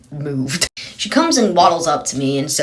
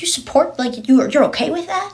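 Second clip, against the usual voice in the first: much higher, incredulous mock voice of a shocked lady; shocked woman; 12 semitones higher than usual. Imitating the appalled woman asking if he supports it, high and shocked. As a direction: play her scandalized disbelief.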